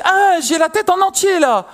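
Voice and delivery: high, slow cry that the head is out; excited labour cry. Retold or imitated. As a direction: push the words out high and slow. Wife in labour, baby coming.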